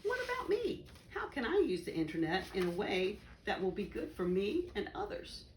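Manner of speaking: ordinary delivery, nothing marked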